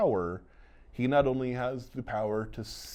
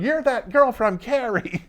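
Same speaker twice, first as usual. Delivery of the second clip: much higher, slow and drawn out; mocking kid voice; 13 semitones higher, 7 dB louder, slower than usual. Host mocking the book's Carrie reference, slow high jeer. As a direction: stretch it out in a goofy high teenage whine. Mock-quoting the kid line.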